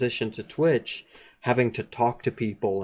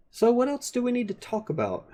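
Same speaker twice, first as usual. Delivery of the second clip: silly high voice; 11 semitones higher than usual. Pretend.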